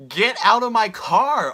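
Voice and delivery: rehearsing how to order a stranger out of her car; firm loud command. Shown as a demonstration. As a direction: throw him out of the car with authority. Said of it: "I'm just gonna be like".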